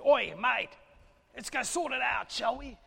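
lightly voiced cheeky geezer; mock cheeky bloke. Nehemiah wrongly barging in to the king, mock casual bloke. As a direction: toss it off like a casual mate.

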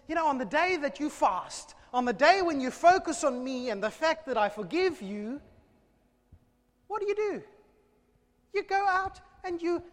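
high, loud booming rebuke; God's loud accusation. Acted. God accusing the people of breaking the fast, loud. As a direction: thunder the charge as God.

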